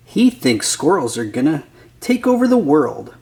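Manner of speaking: ordinary delivery, nothing marked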